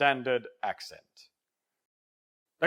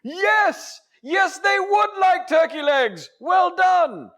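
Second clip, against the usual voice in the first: much higher, loud, elated cheering; triumphant shout; 6 dB louder, slower than usual. Acted. Teacher praising the trainee's turkey leg offer, triumphant shout. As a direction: cry out in hammed-up delight.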